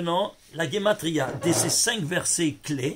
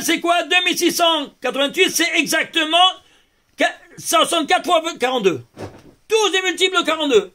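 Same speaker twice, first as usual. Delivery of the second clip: excited; 7 semitones higher, 3 dB louder than usual. Real, not acted. Teacher thrilled by number pattern.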